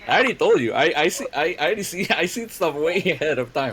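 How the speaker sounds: high, loud gleeful bragging; smug boast. Real, not acted. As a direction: gloat about seeing it coming. DM smug about foreshadowing.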